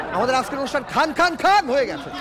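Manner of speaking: high joking shout; mock shout. Joking shout about the name Khan.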